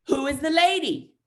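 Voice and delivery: put-on loud, high little-kid demand; imitated kid blurting it at full volume; six-year-old boy. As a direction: mimic a child demanding an answer. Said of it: "six-year-old".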